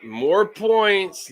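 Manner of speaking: high, loud and drawn out in exasperation; frustrated outburst. Real, not acted. Fan venting at his team, slow and loud. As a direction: drag it out, fed up. Fan fuming over lost points.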